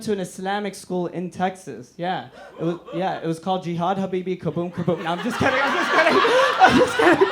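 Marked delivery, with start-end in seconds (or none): 5.1-7.3 higher and louder, laughing through the words. Real, not acted. Comic taking back his joke, laughing. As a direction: laugh it away fast.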